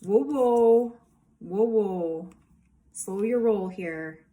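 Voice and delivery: alarmed call to slow down. Retold or imitated. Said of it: "They're like".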